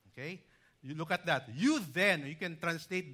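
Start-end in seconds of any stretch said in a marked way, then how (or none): 1.6-2.3 "You" punched loud, "then" light; emphatic you. Shown as a demonstration. Preacher showing the emphatic "You" to the congregation. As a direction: hit "You" to mark the contrast. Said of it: "It is emphatic because Paul was giving a contrast".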